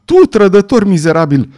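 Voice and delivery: loud, high-pitched accusing yell; howled outrage. A narrator's reading. Mother to the traitor who took her child, howling. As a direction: hurl the accusation at full voice. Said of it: "urlă ea".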